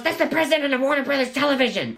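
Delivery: imitating the teacher, high and urgent. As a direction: break the news in a rush. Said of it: "she goes".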